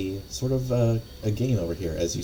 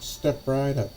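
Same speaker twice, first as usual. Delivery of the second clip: high barker call, trailing off; strange carnival barker voice; 7 semitones higher, slower than usual. Acted. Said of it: "completely different sounding voice than you expect".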